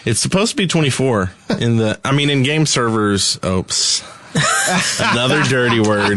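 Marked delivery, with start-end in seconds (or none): 4.3-4.9 squeaky burst of laughter; high-pitched giggle. Real, not acted. Laughs after dirty word.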